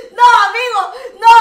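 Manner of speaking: loud shocked protest. Real, not acted. Streamer reacting to the guest's crude poem, loud shocked protest. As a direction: cry out in mock horror. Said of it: "Me has, me has asustado".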